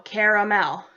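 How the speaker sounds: "caramel" slowly in three syllables, CARE-uh-mel; "caramel" with every syllable given, CARE-uh-mel; caramel as spelt. Shown as a demonstration. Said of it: "spelt C A R A M E L".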